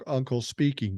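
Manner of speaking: normal talking voice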